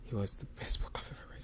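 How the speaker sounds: soft whisper of awe. Real, not acted. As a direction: breathe it out like a secret. Confiding about favourite book.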